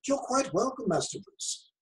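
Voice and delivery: a polite, deferential British butler. Pretend. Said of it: "I wanted to come back and say".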